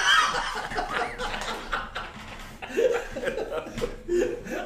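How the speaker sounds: long high laughter. Real, not acted. Friends laughing at the referee punchline. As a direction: howl of laughter.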